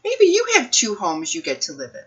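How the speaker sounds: plain reading voice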